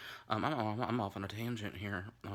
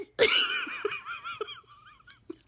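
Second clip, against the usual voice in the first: shrieking laugh; 9 dB louder than usual. Real, not acted. Laughing at own joke.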